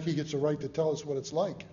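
usual speaking voice

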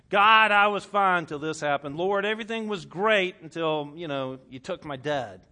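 loud, acted protest to God; blaming complaint. Hurting believer blaming God, in loud acted protest. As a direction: cry out your grievance with force.

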